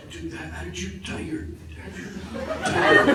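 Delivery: very soft, hesitant imitation of a friend; mock-sheepish, barely voiced; hushed awkward question. Retells awkward question through bathroom door.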